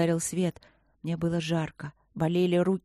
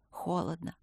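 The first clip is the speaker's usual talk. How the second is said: much softer, fading complaint; faint murmur; 7 dB quieter than usual. A narrator's reading. Patient slipping into darkness, faint murmur. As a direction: let the word out with no strength.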